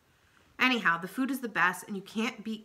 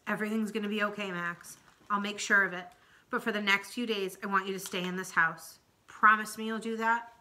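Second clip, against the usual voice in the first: plain reading voice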